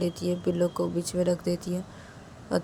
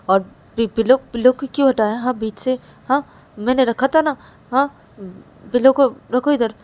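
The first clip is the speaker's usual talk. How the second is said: loud complaint; 5 semitones higher, 8 dB louder than usual. A narrator's reading. Asks why pillow moved.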